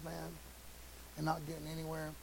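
ordinary delivery

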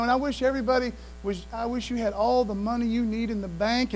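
ordinary delivery

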